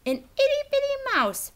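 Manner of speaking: high, light little-mouse voice; tiny mouse voice. A narrator's reading. Reading the mouse's entrance, high and tiny.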